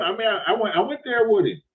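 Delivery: ordinary talk, nothing marked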